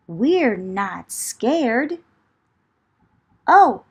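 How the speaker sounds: ordinary delivery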